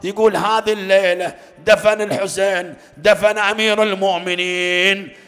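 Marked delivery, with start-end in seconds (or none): none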